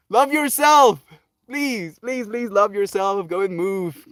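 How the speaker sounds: high, slow heartfelt plea; sincere plea to love yourself; earnest pleading. Real, not acted. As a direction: beg them gently to care for themselves. Pleading with chat to self-love.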